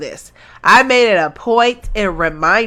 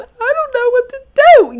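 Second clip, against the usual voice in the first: very high, louder playing-dumb voice; mock helplessness; 5 dB louder than usual. Mocking the mother as playing dumb, mock helpless. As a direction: imitate a woman playing clueless. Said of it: "they just be playing stu".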